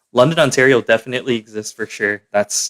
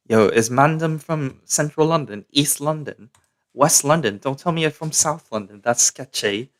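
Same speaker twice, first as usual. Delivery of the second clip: London roadman slang, higher pitched; london roadman accent; 4 semitones higher than usual. Pretend. Continues London roadman bit.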